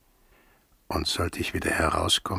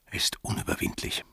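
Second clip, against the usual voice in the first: voiceless, softer whisper; 4 dB quieter than usual. Acted. Sailor muses bitterly about the garden dwarfs, whispering. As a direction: let the thought die away in a whisper.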